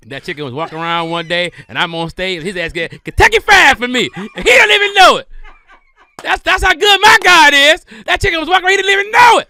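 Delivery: hammed-up preacher shouting high and loud through laughter; mock preacher. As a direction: preach it like a fired-up pastor, laughing. Mock preacher shouting a chicken blessing.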